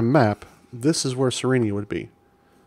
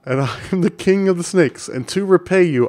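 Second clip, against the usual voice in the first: a lightly voiced snake king, higher and a bit louder; 7 semitones higher, 5 dB louder than usual. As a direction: proclaim it as a mock-royal snake. Reading the snake king's line.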